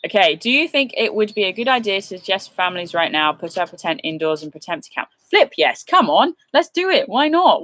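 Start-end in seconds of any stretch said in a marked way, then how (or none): 5.3-7.6 high, eager and upbeat; excited. Real, not acted. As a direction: pump up the listeners. Enthusiastic answer.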